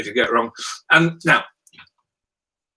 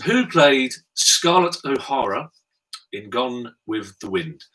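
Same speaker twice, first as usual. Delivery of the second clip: slow question read; 3 semitones higher, slower than usual. Shown as a demonstration. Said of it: "I'll read them slowly".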